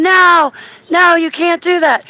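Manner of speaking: high, loud no, no scream; retold screaming. Woman chasing the UFO screams no, loud high scream. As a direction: scream at it to stop.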